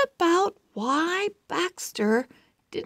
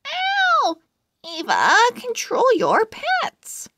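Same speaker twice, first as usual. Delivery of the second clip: pained yelp; 9 semitones higher than usual. Acted. Pinched by crab, complaining.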